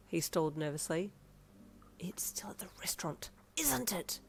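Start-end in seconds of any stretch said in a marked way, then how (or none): 2.0-4.2 unvoiced, softer accusing whisper; hissed whisper. A narrator's reading. Mother hissing at Darby about the forgotten ball. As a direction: hiss it so the child won't hear. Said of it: "you hissed at him".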